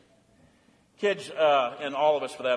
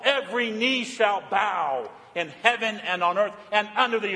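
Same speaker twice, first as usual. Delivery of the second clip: raised, fervent sermon voice; preaching; 7 semitones higher, 4 dB louder than usual. Acted. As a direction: pour it out as worship. Preaching, building to worship.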